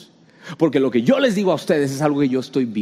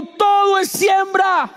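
slow, high, loud chant for the crowd to echo; shouted slogan; 3 dB louder, slower than usual. Shown as a demonstration. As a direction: belt the slogan out slowly. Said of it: "Alguien grite conmigo". (grito lento y agudo para que el público repita)

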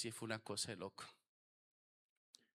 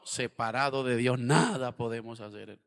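stern proclamation; 12 dB louder than usual. Acted. Preacher states doctrine firmly.

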